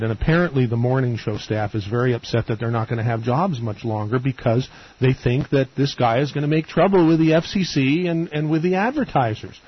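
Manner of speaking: ordinary talk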